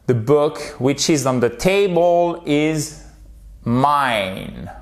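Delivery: slow, careful English for repetition; slow model sentence. Shown as a demonstration. Teacher models a sentence for learners, slow and clear. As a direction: stretch out each word for learners. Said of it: "Répétons-la ensemble".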